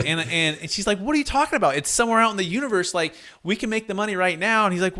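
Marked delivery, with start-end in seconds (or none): 1.0-4.7 high-pitched exasperated wife; mock-incredulous, high-pitched pleading; retold incredulity. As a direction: imitate a wife protesting in disbelief, pitch raised. Wife arguing in retold dinner scene.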